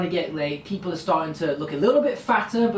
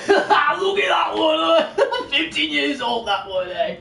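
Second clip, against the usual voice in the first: mocking pig merchant; 9 semitones higher, much slower than usual. Pig merchant mocks the fallen mother to the crowd, jeering shout. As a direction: bellow the insult across the market. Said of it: "He goes".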